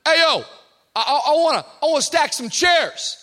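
loud, high, quick challenge; tough kid confronting. Retold or imitated. Tough young guy challenging his pastor, loud and high. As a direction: get in his face with it.

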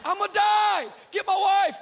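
high, loud panicked shouting; freaking out. Retold or imitated. Injured on the beach, yelling in panic about sharks. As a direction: scream it like a drama king. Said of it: "I'm freaking".

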